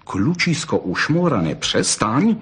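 ordinary delivery

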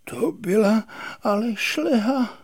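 high, airy sighing voice; thin, breath-filled sigh after a knock; dazed sigh. A narrator's reading. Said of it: "a pak vzdychl".

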